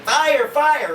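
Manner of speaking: high panicked cry of fire; child shouting fire in alarm. Retold or imitated. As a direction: yell it like the house is burning. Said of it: "Charlotte's like".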